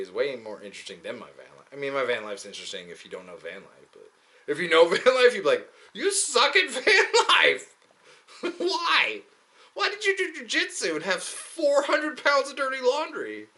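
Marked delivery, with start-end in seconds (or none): 5.9-13.5 high, strained comic complaint; mock heckler. Van life expert berating him, high mock heckling. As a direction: heckle in a much higher put-on voice.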